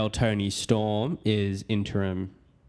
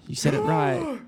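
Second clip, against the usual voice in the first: squeaky high voice. Pretend.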